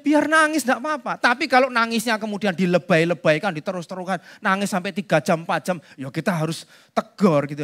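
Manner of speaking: usual speaking voice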